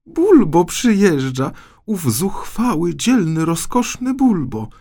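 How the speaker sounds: a princess gushing over her hero; joyful exclamation. Acted. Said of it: "wykrzyknęła radośnie księżniczka". (radosny, wysoki okrzyk księżniczki)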